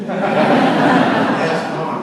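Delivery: loud, laughing attempt at a Boston accent. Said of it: "you can't do it in the Boston accent".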